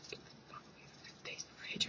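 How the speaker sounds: fast whispered threat. Retold or imitated. Annoyed classmate telling her off, fast whispered threat. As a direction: hiss it fast and low. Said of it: "She'd be like".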